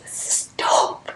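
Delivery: soft hushed delight; whisper. Real, not acted. At Whole Foods, she hushes her delight, whispering. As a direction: breathe it out under your breath. Thrilled at meeting the man.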